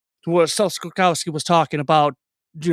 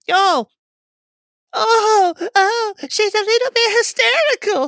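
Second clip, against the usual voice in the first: hysterical waitress; 4 dB louder than usual. Acted. Game master playing the waitress to players, hysterical voice. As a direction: squeal it out as an over-the-top panicky waitress. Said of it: "that annoying, hysterical voice".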